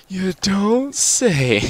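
slow sarcastic you don't say. Real, not acted. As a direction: drag it out like it's obvious. Sarcastic reply to obvious remark.

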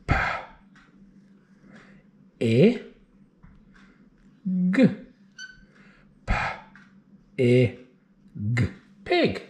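each sound of P, I, G apart, long pauses; sounding out words. Shown as a demonstration. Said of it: "what letters go with those phonemes".

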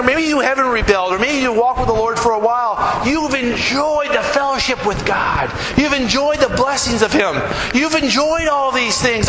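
preaching. Acted. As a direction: press the point home to the faithful. Preacher addresses faithful listeners.

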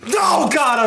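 sharp yell as the shock lands; pained shout. Real, not acted. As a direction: yell out in sudden pain.

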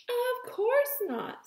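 very high, slow exclamation; shocked. Acted. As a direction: gasp out a shocked denial. Teacher shocked by answer.

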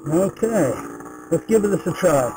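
muffled Bluetooth helmet mic; speaking inside a closed helmet. Real, not acted. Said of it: "I'm gonna be wearing a enclosed helmet".

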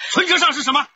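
high, loud accusing challenge; accusing question. Acted. Husband waves the bankbook at his wife, loud and accusing. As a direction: catch her lying.

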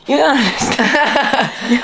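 a lightly put-on teasing voice; mock voice. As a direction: mimic her in a silly voice. Teasing imitation.

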